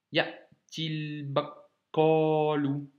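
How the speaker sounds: ya'til bakalu linked slowly as one; liaison. Shown as a demonstration. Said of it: "j'ai fait la liaison". (ya'til bakalu, liaison lente)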